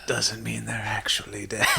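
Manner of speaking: hushed joking whisper. Real, not acted. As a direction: breathe it out as a whisper.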